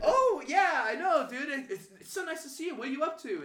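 high, loud, overeager small talk; exaggerated sugary greeting, acted out; fake nice. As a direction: gush with put-on friendliness. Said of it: "gonna be fake nice at first".

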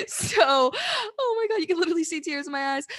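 fighting back real tears while talking; close to tears. Real, not acted. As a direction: voice cracking with real tears, pitch jumping up. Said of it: "I'm literally about to cry".

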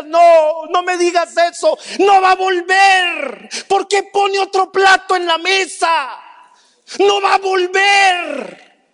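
high, loud furious denial; angry shouting. Retold or imitated. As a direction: shout him down in rage. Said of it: "me quería golpear mientras decía". (negación furiosa a gritos)

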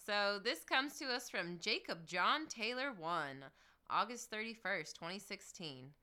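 ordinary delivery

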